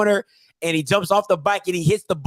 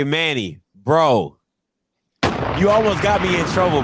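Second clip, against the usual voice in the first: normal talking voice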